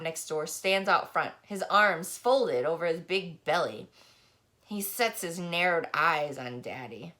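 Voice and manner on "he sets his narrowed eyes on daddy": ordinary delivery, nothing marked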